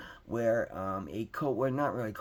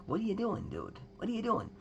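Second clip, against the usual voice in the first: sharp, high mock yell of disbelief; replaying the blow-up at the coworker, pitched up; high exasperated what are you doing; retold angry shout; 9 semitones higher than usual. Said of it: "I was screaming it on the phone".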